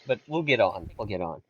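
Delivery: usual speaking voice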